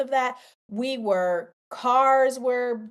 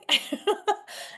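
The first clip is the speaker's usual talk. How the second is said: short high laugh; 10 semitones higher than usual. Real, not acted. Laughs at own exasperation.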